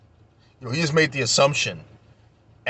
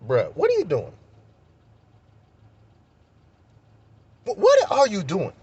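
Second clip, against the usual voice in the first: incredulous confrontation; 7 semitones higher than usual. Retold or imitated. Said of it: "I'm looking at him like".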